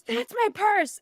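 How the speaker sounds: high, loud mock accusation; imitated yelling. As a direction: act out a woman yelling her claim. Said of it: "yelling, screaming at me".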